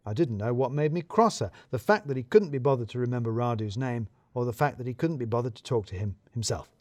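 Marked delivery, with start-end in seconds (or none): none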